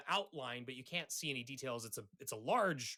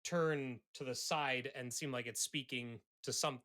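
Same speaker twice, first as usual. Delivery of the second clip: ordinary delivery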